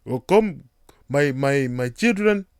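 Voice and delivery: put-on mild Nigerian parent accent; lightly voiced Nigerian parent. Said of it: "a botched up Nigerian accent".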